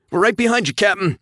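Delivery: high, loud call over the radio. A narrator's reading. Pilot calling to his captain over the radio, loud. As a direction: shout it across the comms. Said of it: "Josh called".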